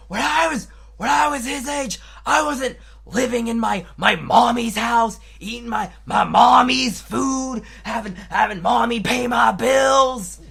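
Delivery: loud, high mock-dad shouting; dad's angry rant. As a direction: imitate an indignant dad on a ranting tirade. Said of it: "Whenever he's in a mood, I have to listen to".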